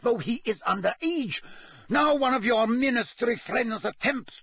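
put-on high-pitched, accented tirade; bellowed rage. A narrator's reading. Karkaroff raging at Dumbledore over a plot, bellowing. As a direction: rant in outrage, pitched far up. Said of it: "he bellowed".

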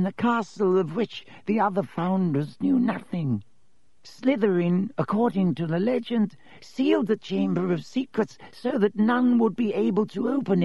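put-on high, thin, dry old lecturer; dry reedy voice. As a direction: drone it like a dusty ghost professor. Ghost professor tells legend.

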